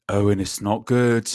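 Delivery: slow, put-on lament of a mate; hammed-up gloomy friend; mock worried teammate. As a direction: drag it out like it's all over.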